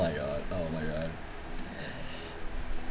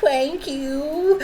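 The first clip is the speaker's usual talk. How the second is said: cutesy thanks; 12 semitones higher, 3 dB louder than usual. Pretend. Cutesy thanks after redeem.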